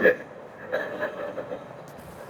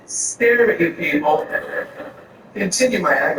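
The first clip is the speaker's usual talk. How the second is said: ordinary delivery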